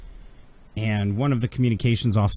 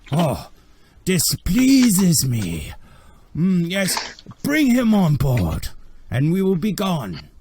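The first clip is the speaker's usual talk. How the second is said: slow, higher, savoured gloating voice; pleased villain; 7 semitones higher, 4 dB louder, slower than usual. Acted. The boss relishing the captured prisoner, slowly gloating. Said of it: "then he's like".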